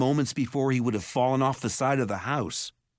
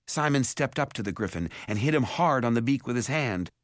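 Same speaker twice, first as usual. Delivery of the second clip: ordinary delivery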